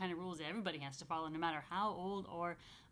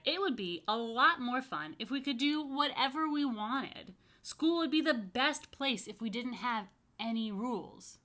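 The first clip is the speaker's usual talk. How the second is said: put-on higher, louder child dreaming aloud; excited boy; 4 semitones higher, 5 dB louder than usual. Michael telling the class, loud and eager. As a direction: sell the dream like a keen kid. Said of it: "Michael replied".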